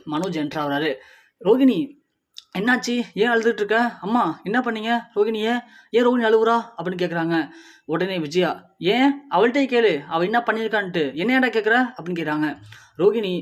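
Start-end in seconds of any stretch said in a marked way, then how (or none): 1.4-6.6 higher and louder worried calls; alarmed questioning. Acted. Husband finding his wife in tears, alarmed and loud. Son finds wife crying. 8.9-11.8 higher and louder, snapping at the son; angry retort. Acted. Mother telling her son to ask Rohini himself, angry.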